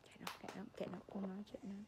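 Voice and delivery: fast, hushed whisper; conspiratorial whisper. Real, not acted. Whispering so baby won't hear.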